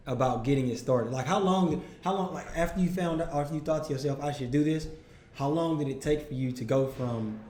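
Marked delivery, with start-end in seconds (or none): none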